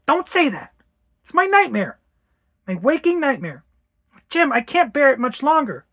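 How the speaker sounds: high, loud, desperate pleading; distressed cry. A narrator's reading. Wife confessing nightmare to husband, high and distressed. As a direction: plead loudly as if at breaking point. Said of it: "I cried".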